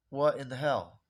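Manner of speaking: ordinary talk, nothing marked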